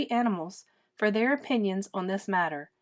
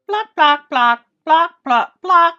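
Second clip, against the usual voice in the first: high, loud clucking hen; high loud hen plock; 8 semitones higher, 14 dB louder, slower than usual. Acted. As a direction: cluck it out like a chicken. Reader voices hen clucking.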